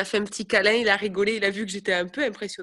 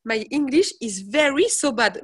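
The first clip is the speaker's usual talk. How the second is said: put-on slow, louder broken English with a French accent; 3 semitones higher, 5 dB louder, slower than usual. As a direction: plead slowly in bad English. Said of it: "J'ai dit".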